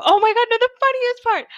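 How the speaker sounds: sudden high, loud excited outburst; excited burst. Real, not acted. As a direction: blurt it out as it hits you. Suddenly remembers the funniest part.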